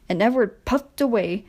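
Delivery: ordinary delivery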